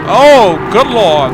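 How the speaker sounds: real startled outcry; shocked. Real, not acted. Player reacting to the burning house, shocked.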